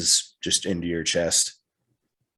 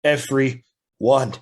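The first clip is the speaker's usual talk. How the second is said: higher, louder desperate rant; desperate anger; 8 semitones higher, 3 dB louder than usual. Acted. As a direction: pour out frustration, voice climbing. Desperate character raging.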